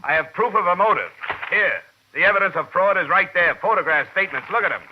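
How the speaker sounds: pressing it angrily; heated insistence. Acted. Detective pushes the superintendent to arrest Meadow.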